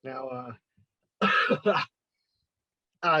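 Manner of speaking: ordinary talk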